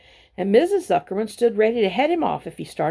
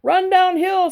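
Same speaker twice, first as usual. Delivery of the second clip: much higher, loud calling cow; 12 semitones higher, 6 dB louder, slower than usual. A narrator's reading. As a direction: shout advice across the yard. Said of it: "suggested the cows".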